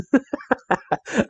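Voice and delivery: high-pitched amused giggle. Real, not acted. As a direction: giggle high at the silly result. Laughs at silly item combo.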